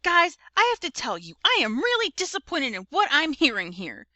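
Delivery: stern motherly lecture; scolding. A narrator's reading. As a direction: tell the kids off, letting disappointment show. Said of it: "Mom scolded".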